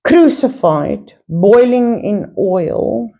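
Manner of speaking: ordinary talk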